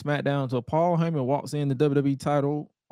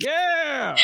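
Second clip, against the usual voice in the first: holler; 12 semitones higher than usual. Retold or imitated. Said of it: "I hollered".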